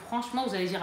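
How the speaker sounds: ordinary delivery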